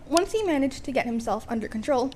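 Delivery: ordinary delivery, nothing marked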